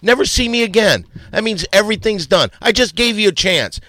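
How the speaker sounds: loud, high-pitched tirade; angry outburst. Real, not acted. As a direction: blow up and tell them it is over. Blow-up in heated argument.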